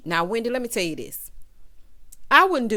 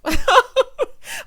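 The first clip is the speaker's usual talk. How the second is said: loud, high laugh; short, high-pitched belly laugh; burst of laughter; 9 dB louder than usual. Real, not acted. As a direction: crack up suddenly. Amused by the apology.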